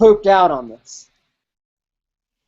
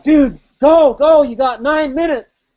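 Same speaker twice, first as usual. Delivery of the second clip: high, loud urging; cheering; 7 semitones higher, 4 dB louder than usual. Retold or imitated. Spectator pushing the rider to finish, loud. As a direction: shout him onward. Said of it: "he's like".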